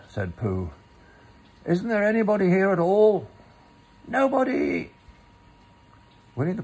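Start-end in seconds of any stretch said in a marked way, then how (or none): 1.6-3.3 high, loud, fast call. Acted. Pooh calling again into the hole, loudly. 4.1-4.9 very high, sharp single word; squeaky denial. Acted. Rabbit pretending nobody is home, squeaky.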